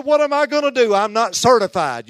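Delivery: high, very loud wail of alarm, acted out; panicked outcry. Nervous church member protesting to her pastor, panicked shout. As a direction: cry it out like someone in over her head.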